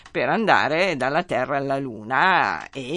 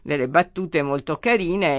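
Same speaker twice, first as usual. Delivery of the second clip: ordinary talk, nothing marked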